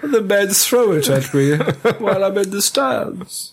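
slow, high villain voice with laughter; mock film villain. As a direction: declaim nonsense as a grand villain. Continuing the villain hot dog bit.

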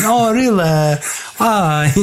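high, swooping fake American; bad American accent. Shown as a demonstration. Host showing co-host his new American accent, badly faked. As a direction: show off a dodgy American accent. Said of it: "That's my new American accent".

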